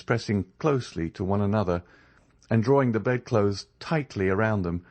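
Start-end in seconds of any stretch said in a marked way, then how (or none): none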